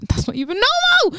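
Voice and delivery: high-pitched exclamation of disbelief; indignant outburst. Real, not acted. Friend objecting to calling leering guys normal, indignant. Indignant aside while telling her story.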